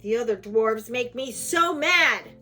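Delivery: loud cross dwarf, swelling; loud, exasperated outburst; angry complaint. A narrator's reading. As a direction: build to a furious shout on the last words.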